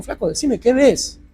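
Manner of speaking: reenacted impatient confrontation; insistent demand. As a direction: press urgently for an answer. Acted confrontation with the radiologists.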